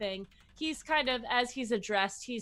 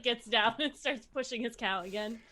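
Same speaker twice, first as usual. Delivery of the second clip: normal talking voice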